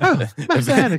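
high, fast droid voice; high-pitched excited droid impression; C three P O. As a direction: gush it high and quick through laughter. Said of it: "he's like".

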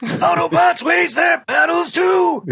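slow, high shouted rock growl in [name]'s manner; [name] impression. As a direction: belt it out slowly like a metal frontman doing a Transformers theme. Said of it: "that was my best [name]".

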